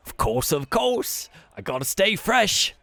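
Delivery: high, slow, loud boast; boastful Yagra. Acted. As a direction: flex and brag in a high voice. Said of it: "and he's".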